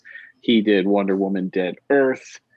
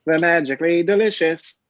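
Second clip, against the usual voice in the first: a high, hammed-up Irish lilt; bad Irish accent; 7 semitones higher than usual. Cohost adds a tag line after the Irish bit, in a hammed-up Irish accent. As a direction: toss off a cereal-ad Irish accent as a joke. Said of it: "I thought I was gonna just at the end just".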